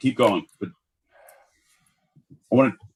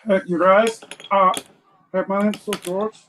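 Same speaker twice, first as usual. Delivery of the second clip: put-on higher, halting kid voice; little boy George; 6 semitones higher, 3 dB louder than usual. Little George interrupting his brothers, timid child. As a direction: pipe up like an overlooked kid.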